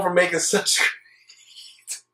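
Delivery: whispered with a stifled laugh. Real, not acted. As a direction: snicker it under your breath. Sarcastic aside about dubs.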